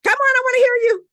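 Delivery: high, loud and quick, egging the crowd on; coaxing the audience to shout it out; urging the audience. Real, not acted. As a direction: pull the answer out of them, voice up. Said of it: "What's the answer?".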